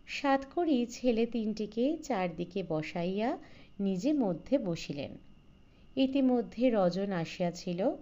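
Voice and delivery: ordinary delivery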